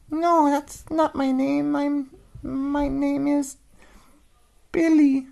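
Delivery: high, tiny mouse voice; little voice. Acted. Mouse answering the old dog, tiny and high. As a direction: squeak it out small.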